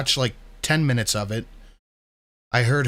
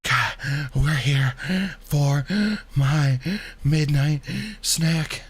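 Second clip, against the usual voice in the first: mocking impression; 3 semitones higher, much slower than usual. Mocking the streamer's intro.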